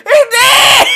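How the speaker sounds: laughing shout. Real, not acted. Laughing outburst.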